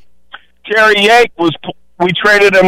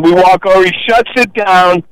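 normal talking voice

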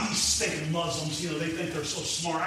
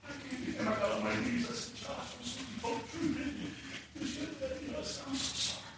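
tearful and quiet; 3 semitones higher, 12 dB quieter than usual. Real, not acted. Father confessing to his son, hushed and tearful. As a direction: tell it barely holding back tears. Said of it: "I started crying".

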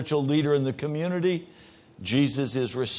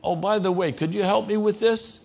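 casual asking between equals; 7 semitones higher than usual. Retold or imitated. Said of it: "We say".

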